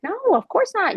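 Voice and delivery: high-pitched, soothing "oh no" to a parent; retold reassurance. Daughter answering her mother's apology for annoying them, high-pitched reassurance.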